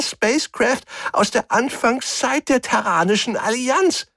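very high, croaky little alien; croaking alien. Acted. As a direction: croak it high like a cornered stowaway. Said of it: "krächzte der Mombri".

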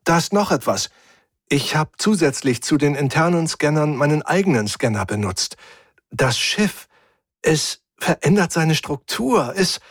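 worried, high-pitched report; Efi. A narrator's reading. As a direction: break urgent news, hesitating in worry. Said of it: "augenscheinlich sehr besorgt". (besorgte, hohe Stimme)

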